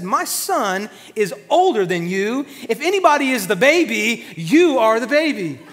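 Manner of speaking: mock scolding. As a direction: tell the nephew off, half joking. Uncle scolding nephew in car.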